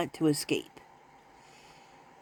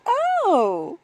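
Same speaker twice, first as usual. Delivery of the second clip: quoting Pam; 10 semitones higher, slower than usual. Retold or imitated. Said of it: "Pam says".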